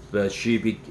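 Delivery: usual speaking voice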